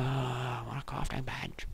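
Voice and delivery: whispered quiet wish; whispering. Real, not acted. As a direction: whisper it to yourself.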